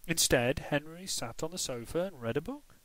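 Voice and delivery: ordinary delivery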